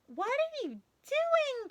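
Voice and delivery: high, slow calling friend; high called question; calling Gertie. Acted. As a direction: call across to Bernard. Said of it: "Gertie called".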